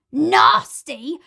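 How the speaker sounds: loud, outraged "Nasty?"; indignant cry. Acted. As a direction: cry it out, offended. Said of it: "cried the crocodile".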